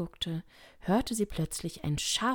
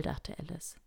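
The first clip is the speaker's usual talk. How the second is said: ordinary delivery, nothing marked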